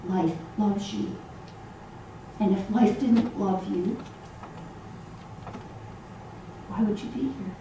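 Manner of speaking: soft, unhurried reassurance with long pauses; gentle, kind. Shown as a demonstration. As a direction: say it softly and kindly, as to someone who needs comfort. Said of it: "gentle, kind way".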